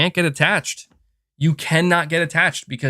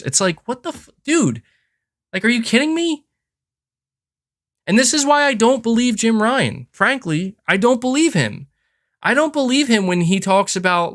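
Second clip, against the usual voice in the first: higher pitched, incredulous outburst; exasperated rant; 9 semitones higher than usual. Real, not acted. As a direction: pitch jumps up in disbelief at the CEO. Ranting at Jim Ryan's remarks.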